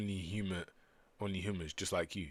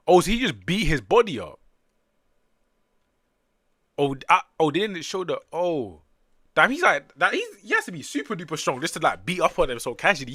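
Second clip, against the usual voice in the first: higher and louder, rushing with excitement; amazed; 10 semitones higher, 14 dB louder than usual. Real, not acted. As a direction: marvel at how strong he is. Amazed reaction to fight.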